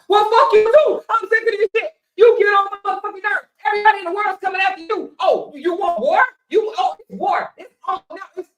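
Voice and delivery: high, loud furious outburst; hammed-up rage, loud and high; mock angry yell. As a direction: blow up at them. Imitated man snaps again.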